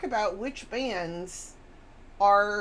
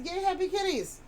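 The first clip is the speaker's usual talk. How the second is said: higher, bright cheer for the kitties; chirpy read-out; 10 semitones higher than usual. Acted. Reading a viewer's cat cheer, high and excited. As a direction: read it like a happy squeal. Reading chat message aloud.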